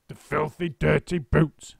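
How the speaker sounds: slow, loud growled curse; yelled. A narrator's reading. Mister Twit cursing the birds to his wife. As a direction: spit out each insult slowly. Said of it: "yelled Mister Twit".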